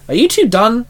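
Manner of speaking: high, exasperated question; impatient. A narrator's reading. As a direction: sound fed up with them both. Shinzo, impatient.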